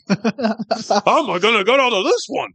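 a deliberately bad Coach Martinez accent, played for laughs. Podcast host voicing the impaled coach in a bad Coach Martinez accent. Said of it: "my famously inaccurate Coach Martinez accent".

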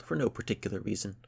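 plain reading voice

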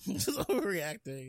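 mock echo through a laugh. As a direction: repeat it laughing mockingly. Echoing mockingly with laugh.

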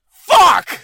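put-on loud, high proud-kid shout; mock-child yell. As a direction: blurt it gleefully like a small child. Imitating kid yelling to his mom.

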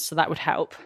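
ordinary delivery

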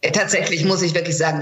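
ordinary talk, nothing marked

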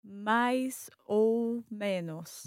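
"mais ou menos" very slowly, "ou" as "oh"; slow learner's "mais, ou, menos"; long clear O. Shown as a demonstration. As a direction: stretch each word, holding "ou" as O. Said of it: "make it sound like a O".

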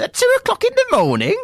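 very high mock-outraged question; imitating incredulous mum. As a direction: squeal it like an appalled mum.

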